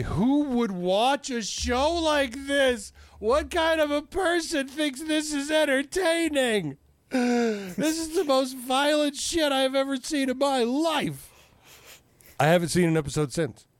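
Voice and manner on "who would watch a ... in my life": very high-pitched, appalled imitation; hammed-up horrified outrage in a squeaky high voice; mock horror. Imitating his horrified partner after a violent episode, high appalled voice. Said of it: "face covered in horror".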